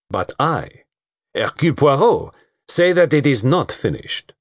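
lightly voiced Belgian detective, grand; Poirot declaring to the gathered household; Poirot's voice. A narrator's reading. Poirot addresses the group.

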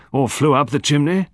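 colonel's sarcastic scepticism; sceptical. A narrator's reading. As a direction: offer the absurd idea in open disbelief. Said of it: "said Colonel Berry sceptically".

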